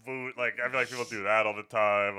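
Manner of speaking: normal talking voice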